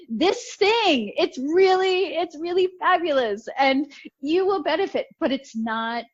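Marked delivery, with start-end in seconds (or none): 0.1-5.1 high, gushing hard sell; overeager sales pitch. Retold or imitated. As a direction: oversell it with bright enthusiasm. Pitching a program too eagerly.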